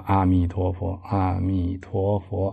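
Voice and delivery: soft, low, drawn-out chanting; slow recitation. Shown as a demonstration. Teacher demonstrates counting recitations after a taxi chat, slow soft chanting. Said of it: "念慢".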